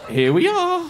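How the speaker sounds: high, loud and falsely cheery; mock cheery announcement. Dropping them at the wrong street, mock cheery.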